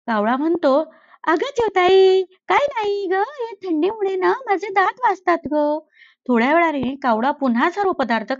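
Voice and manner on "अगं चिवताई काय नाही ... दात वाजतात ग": lightly higher, innocent-sounding crow; fibbing crow. Acted. Crow lies to the sparrow about the crunching, mild innocent tone. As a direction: cover it up, play innocent.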